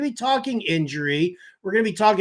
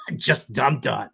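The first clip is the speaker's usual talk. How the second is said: ordinary talk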